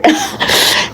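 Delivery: high breathy disbelieving laugh; a sharp airy laugh at the nerve of it; incredulous laugh. Real, not acted. Incredulous laugh mid-rant.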